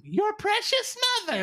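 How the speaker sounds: high squeaky cartoon sneer; exaggerated cartoon intonation. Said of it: "the way they're moving as they're saying".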